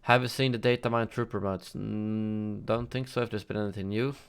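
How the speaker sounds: ordinary talk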